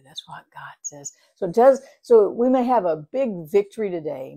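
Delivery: usual speaking voice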